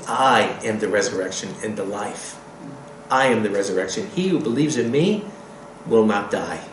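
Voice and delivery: low, soft solemn declaration; voice of Yeshua. Acted. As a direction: speak it as a calm, deep promise. Preacher voices Yeshua's answer.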